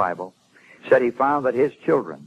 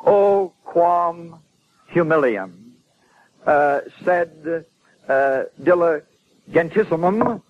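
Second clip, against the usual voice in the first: slow, higher Latin with drawn-out words; halting Latin recitation; 4 semitones higher, much slower than usual. Retold or imitated. Preacher recalling another man's Latin outpouring, halting and declaimed. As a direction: recite Latin piece by piece, searching for each word. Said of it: "Something like this".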